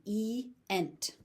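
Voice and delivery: "E-ent" as ee, then ent; two distinct vowels in "E-ent"; vowels pronounced separately. Shown as a demonstration. Teacher explaining two middle vowels, "E-ent" said separately. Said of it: "they're pronounced separately".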